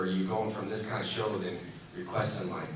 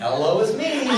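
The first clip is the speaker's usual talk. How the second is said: high, loud, laughing greeting; laughing hello; 11 semitones higher, 7 dB louder than usual. Retold or imitated. Telling the crew who is in the suit, high laughing greeting. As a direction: announce yourself through laughter. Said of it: "I'm like".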